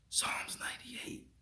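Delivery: high, slow airy whisper; mysterious whisper. Retold or imitated. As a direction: breathe it like a heavenly voice. Voice whispers in his ear.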